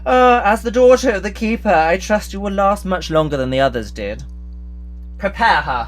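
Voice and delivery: loud, high triumphant gloat; gloating villain. Acted. Villain gloats over the keeper's daughter, loud and triumphant. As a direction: crow over her as you give the order.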